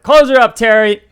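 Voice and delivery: shouting up. Acted. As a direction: yell it up the ladder. Big shouts up the ladder.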